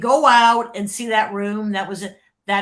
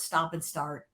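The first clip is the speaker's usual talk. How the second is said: ordinary talk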